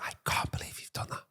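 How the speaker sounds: cold, breathless whisper of disapproval; stern whisper. Retold or imitated. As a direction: scare the kid without raising your voice. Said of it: "I'd whisper at them".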